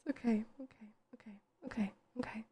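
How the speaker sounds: unvoiced whisper, calming down; whispered to self. Real, not acted. Streamer fighting back tears, whispering to herself. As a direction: whisper it to steady yourself. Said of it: "I'm just trying not to cry".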